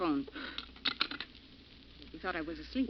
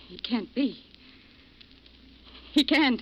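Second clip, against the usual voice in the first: ordinary talk, nothing marked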